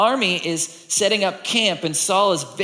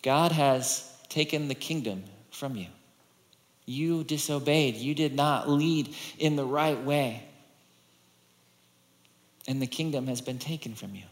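solemn judgment; 3 semitones lower, 6 dB quieter than usual. Acted. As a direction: deliver the verdict softly, with long pauses. Voicing Samuel's judgment on Saul.

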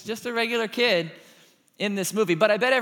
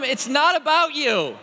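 louder and higher, slow and deliberate; emphatic demo line; 6 semitones higher, 5 dB louder, slower than usual. Shown as a demonstration. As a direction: say it out for the room to repeat. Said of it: "Tell them".

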